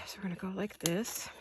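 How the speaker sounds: whispered while holding breath. Real, not acted. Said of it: "I'm holding my breath while I cut".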